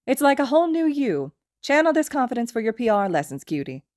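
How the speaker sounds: a hint of a playful, flirty teacher. A narrator's reading. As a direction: tease him, lightly flirty.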